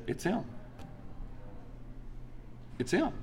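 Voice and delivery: very soft, with a long pause between; hushed realization. Retold or imitated. Father at sunrise looking at his baby son, hushed realization. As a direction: let the revelation land almost under your breath.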